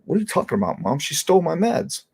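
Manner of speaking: rising indignation, pitch up; mock exasperation at mom; patient protesting to mother, exasperated. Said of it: "I'm like".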